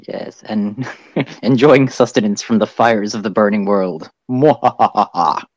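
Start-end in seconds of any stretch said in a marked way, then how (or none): 4.3-5.4 slow villain mwahahaha; evil laugh. Acted. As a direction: cackle like a cartoon villain. Evil laugh after burning world.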